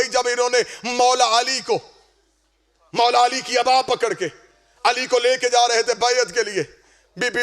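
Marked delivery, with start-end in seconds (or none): none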